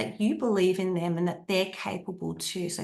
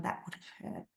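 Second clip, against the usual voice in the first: soft, breathy whisper; gently whispering comfort to a hurt child; whispered empathy; 12 semitones lower, 14 dB quieter than usual. Shown as a demonstration. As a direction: hush it to the child.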